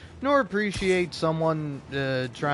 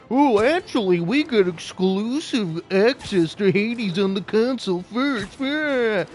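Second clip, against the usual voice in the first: high, loud, snooty mocking company voice; smug Nintendo; 7 semitones higher, 6 dB louder, slower than usual. Gamer mocking Nintendo as a smug company, high and loud. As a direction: sneer it like a gloating corporation. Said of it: "Nintendo is gonna be like".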